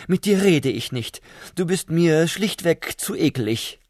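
high, squeaky and scornful; contemptuous squeak. Acted. Prawn sneering at the ugly slug, high contemptuous squeak. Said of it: "In verächtlichem Ton fiepste sie".

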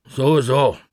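anxious pet shop owner talking to the answering machine; Mortimer the pet shop owner, nervous. Acted. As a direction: rush the message, worried and flustered.

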